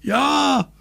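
very high, tiny-voiced call; high, drawn storybook cry. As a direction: call it in a squeaky little voice. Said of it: "rief Lottchen".

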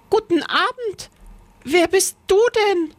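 put-on high, curious girl; Lisbeth. A narrator's reading. Lisbeth meets someone in the cellar, curious. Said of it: "fragte sie".

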